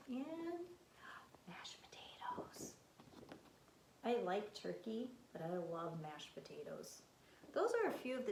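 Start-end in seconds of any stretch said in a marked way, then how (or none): 1.5-2.7 1 whisper. Real, not acted. Teacher listing foods, hushed.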